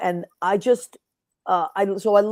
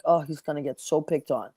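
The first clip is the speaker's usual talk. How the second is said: worried murmur; 6 semitones lower, 6 dB quieter than usual. Retold or imitated. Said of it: "I was like".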